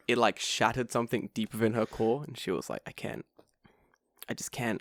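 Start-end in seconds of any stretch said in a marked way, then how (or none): 2.9-4.8 soft, helpless murmur; imitated defeat. Teacher whose belief just shattered, soft defeated imitation. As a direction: trail it out softly in mock shock.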